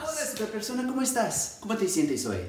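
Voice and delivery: high-pitched kindergarten teacher hello; sing-song high greeting; bubbly classroom greeting. Acted.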